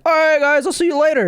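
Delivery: put-on high-pitched oblivious drunk goodbye; breezy drunk. Storyteller mimics a blackout drunk leaving a party, breezy. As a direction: imitate a drunk cheerfully heading off.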